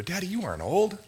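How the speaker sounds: a hint of a sweet little son; little boy. Pastor quoting his young son to the church, lightly childlike.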